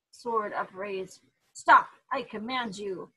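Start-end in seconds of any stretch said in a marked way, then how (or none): 1.5-3.1 explosive opening, then authoritative; commanding shout. A narrator's reading. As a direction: shout a sudden imperial command. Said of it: "never heard Ichigo use that tone".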